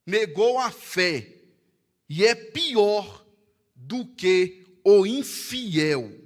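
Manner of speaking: slow, weighty reading of the verse; solemn, drawn-out scripture reading. Acted. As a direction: read the verse slowly, letting each phrase land. Preacher reads scripture.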